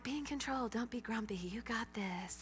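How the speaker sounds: hushed pep talk to oneself, sinking to a whisper; grudging self-talk. Retold or imitated. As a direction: talk yourself down under your breath, slowing at the end. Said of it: "grudgingly, I'm like".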